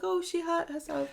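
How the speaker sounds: high, cooing imitation of a doting woman; a lightly mocking high baby-talk voice; fussing aunt. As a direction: coo it like she's fussing over a baby. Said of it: "she's like".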